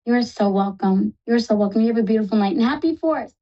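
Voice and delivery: ordinary talk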